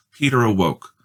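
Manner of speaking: plain reading voice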